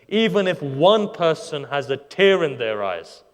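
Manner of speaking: loud and slow, rising; fervent preaching. Real, not acted. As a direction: hammer each word to the crowd. Preacher's climax on the tradition.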